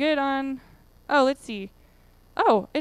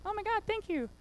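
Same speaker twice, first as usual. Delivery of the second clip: much higher, breathy-soft mock relief; mock gushing gratitude; 8 semitones higher, 9 dB quieter than usual. Presenter answers the fake ad as a gushing, much higher customer. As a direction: play the overjoyed customer.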